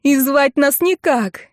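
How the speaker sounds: high wail, slowing to a drawl; relentless lament. A narrator's reading. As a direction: keep bemoaning the family's ruin. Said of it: "не переставала Ирка". (не переставая причитает)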